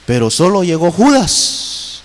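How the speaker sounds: usual speaking voice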